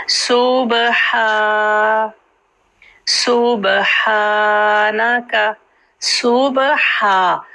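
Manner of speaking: सूबहा said slowly with a clear breathy ह; सूबहानका recited slowly with every sound distinct; सूबहा slow, ह clearly voiced; Qur'anic recitation. Shown as a demonstration. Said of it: "तो बा को ऐसे ही पढ़ना है".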